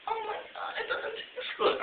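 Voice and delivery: very high girl imitation; hammed-up, high-pitched whine; mock complaint. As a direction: squeak it out in a put-on high voice. Acted girl complaining in story.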